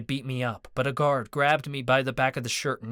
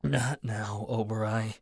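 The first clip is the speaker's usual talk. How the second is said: growled; 2 semitones lower, slower than usual. A narrator's reading. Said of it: "I growled".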